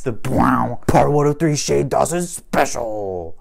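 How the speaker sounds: slow, drawn-out title boom with a sound effect; hammed-up announcer, stretching the last word; mock trailer announcer. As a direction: parody a bombastic special announcement. Mocks the special's bombastic title.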